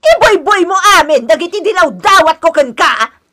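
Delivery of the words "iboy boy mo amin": loud, tearful cry of complaint; crying accusation. Acted. Mother-in-law cries out at her daughter-in-law, tearful. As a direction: wail the accusation through tears.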